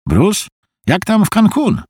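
a lighter, brighter pitch for the friend; friend Harvey on the phone. Acted. Harvey picks up Bruce's call, casually cheerful.

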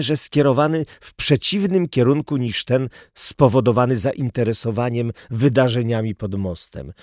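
plain reading voice

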